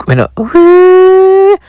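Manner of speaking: high, loud imitated kettle whistle; long piercing kettle whistle.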